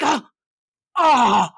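loud imitated groan of pain. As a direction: moan through hurting hands. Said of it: "he's in a lot of pain".

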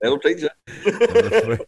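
slow, laughing told-you-so; laughing mock scold. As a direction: drag it out through a laugh.

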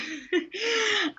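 high laugh that breaks off the thought; short high giggle; burst of laughter. Real, not acted. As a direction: laugh it out, amused at yourself. Laughs at her own answer.

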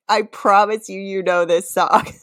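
normal talking voice

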